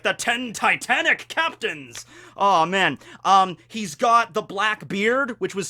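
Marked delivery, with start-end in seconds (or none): 0.0-2.0 slow, higher cartoon villain proclamation; Blackbeard. Fan mimics the cartoon villain naming his crew, slow hammy proclamation. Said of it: "and he's like".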